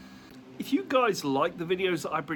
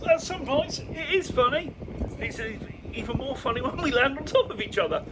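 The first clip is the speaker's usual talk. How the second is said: laughing; 10 semitones higher than usual. Real, not acted. Laughing about falls.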